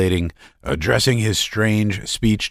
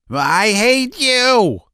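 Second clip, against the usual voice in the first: slow hateful line; 4 dB louder, much slower than usual. A narrator's reading. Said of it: "Laevsky said softly".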